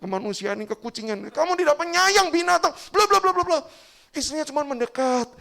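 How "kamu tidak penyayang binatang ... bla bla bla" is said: much higher, louder furious yell; angry husband. Acted. Husband yelling at his wife over the dead cat, furious. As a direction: let him explode at his wife. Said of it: "langsung marah kepada istrinya".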